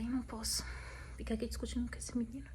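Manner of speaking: unvoiced whisper, holding herself back. A wife whispering to herself at night, so she won't fight her husband. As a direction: hiss it under your breath, barely voiced.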